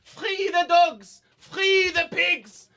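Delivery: hammed-up euphoric shout; ecstatic proclamation. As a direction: cry it out like a prophet set free. Enlightened waiter proclaims.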